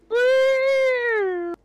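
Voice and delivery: very high, loud "woo"; excited whoop. Real, not acted. Player cheering mid-game, high loud whoop. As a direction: let out a piercing cheer.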